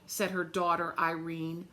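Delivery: plain reading voice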